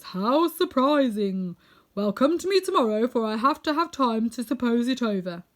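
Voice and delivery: loud, grand wizard voice. A narrator's reading. Unseen wizard puts off the visitors, loud and grand. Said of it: "said the voice".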